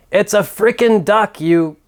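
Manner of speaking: nearly snapping at her over the duck; exasperated outburst. Acted.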